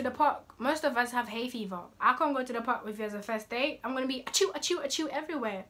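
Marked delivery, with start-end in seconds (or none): 4.2-5.1 spoken "achoo", rattled off in series; mock sneezing. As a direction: pretend sneezes in a quick run. Acts out hay fever sneezes.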